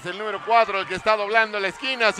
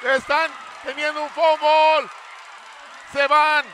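higher, louder urgent call; excited play-by-play; 6 semitones higher, 5 dB louder than usual. Real, not acted. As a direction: call the fumble in alarm. Fumble called live.

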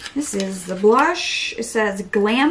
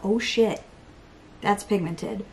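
ordinary delivery

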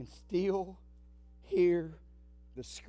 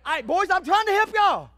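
exasperated shout; 10 semitones higher, 10 dB louder than usual. Real, not acted. Exasperated aside to the boys.

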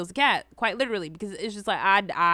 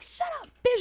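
put-on high-pitched small child voice; little kid. Little kid to his teacher, mock child voice. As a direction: imitate a cheeky kid, pitched way up. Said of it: "little kid telling his teacher".

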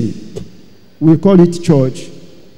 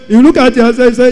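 usual speaking voice

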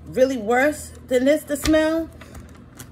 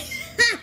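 high laugh; 11 semitones higher than usual. Real, not acted. Laughs while struggling.

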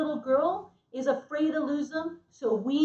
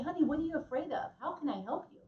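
gentle concern; 3 semitones higher, 7 dB quieter, faster than usual. Retold or imitated. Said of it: "he's gonna say".

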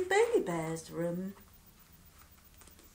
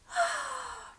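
relieved gasp. Acted. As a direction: gasp like you finally get to rest. Bear finally in bed.

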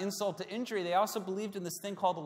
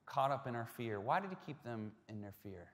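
ordinary talk, nothing marked

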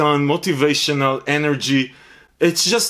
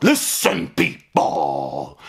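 exaggerated catchphrase; much slower than usual. Reviewer calling out to viewers mid-review, exaggerated drawn-out catchphrase.

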